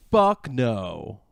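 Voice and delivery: usual speaking voice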